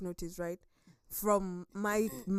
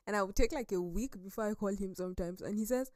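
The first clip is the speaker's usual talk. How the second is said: normal talking voice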